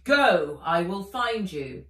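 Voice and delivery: loud urgent dragon voice; dragon commanding. Acted. Fizz urging Nat to run, loud. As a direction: order her to go, loud. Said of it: "he said".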